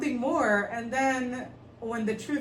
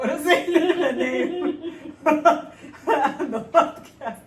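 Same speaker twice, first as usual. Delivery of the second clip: breaks into giggles mid-sentence, higher and louder; laughing through it; 6 semitones higher, 7 dB louder, slower than usual. Real, not acted. To cohost, repeating herself while laughing helplessly. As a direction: laugh your way through the words.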